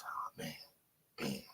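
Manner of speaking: a hushed, quick mock of a defeated man; whispered mocking mumble. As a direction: whisper it like someone giving up and going to bed. Mocking Fanon's words again.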